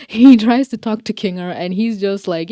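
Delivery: ordinary talk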